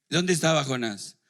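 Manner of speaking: ordinary delivery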